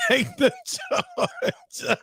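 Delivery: very high, drawn-out words through laughter; helpless laughter. Real, not acted. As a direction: squeal it out while laughing. Cracks up telling wife's offer.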